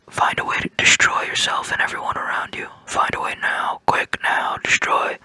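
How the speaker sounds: eerie whisper from the back of the head; creeping inner voice. Retold or imitated. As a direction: hiss it like a sinister voice tempting you. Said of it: "my little creeping Bren Bren voice".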